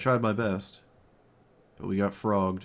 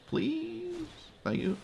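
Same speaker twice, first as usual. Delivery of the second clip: high pleading; 5 dB quieter, much slower than usual. Real, not acted. Begging opponent to play commander.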